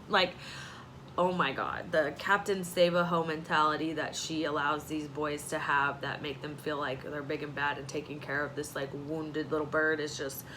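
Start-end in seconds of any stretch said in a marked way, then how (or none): none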